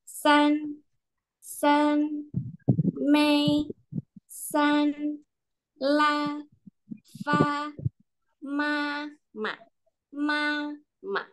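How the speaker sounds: san, la, fa, ma held high and even; teacher drills tone one on syllables; high level tone. Shown as a demonstration. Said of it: "nada satu itu may".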